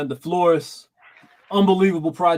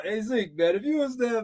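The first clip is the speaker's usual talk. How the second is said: ordinary delivery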